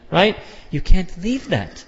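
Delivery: ordinary talk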